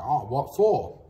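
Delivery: indignant question, re-enacted; challenging retort. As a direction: throw it back at the officer, voice jumping up. Retelling his challenge to the officer.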